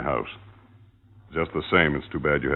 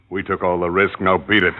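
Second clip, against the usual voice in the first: louder, higher, dismissive bark; angry shout; 4 semitones higher, 6 dB louder than usual. Acted. Jake throwing his girlfriend out, angry shout. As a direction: shout her out the door.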